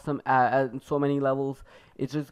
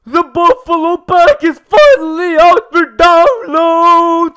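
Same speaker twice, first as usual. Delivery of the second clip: hyped announcement; 12 semitones higher, 15 dB louder, much slower than usual. Real, not acted. Big announcement to viewers.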